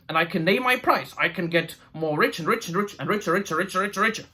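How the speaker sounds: mock greedy king drooling over riches; greedy dwarf king. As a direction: ham up a money-mad dwarf lord. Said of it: "he's like".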